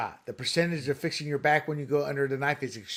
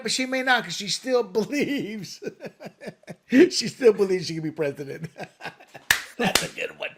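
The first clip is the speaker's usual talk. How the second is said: laughing; 4 semitones higher than usual. Real, not acted. Laughing at Kamala.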